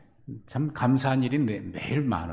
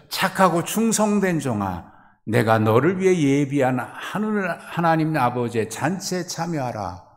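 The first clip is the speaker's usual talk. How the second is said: raised, loud solemn invitation; God's words; 5 semitones higher, 5 dB louder than usual. Acted. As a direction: speak as a master welcoming his servant. Quoting God's parable words. (높고 크게 선포하는 주인의 목소리)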